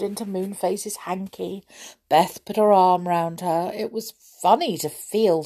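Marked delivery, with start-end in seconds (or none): none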